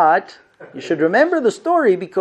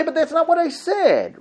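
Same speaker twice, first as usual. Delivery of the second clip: raised, baffled defense; bewildered protest; 10 semitones higher than usual. Retold or imitated. Husband answering his wife, baffled raised protest. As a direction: plead innocence helplessly.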